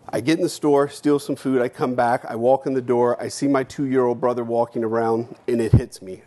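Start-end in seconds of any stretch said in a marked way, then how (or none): none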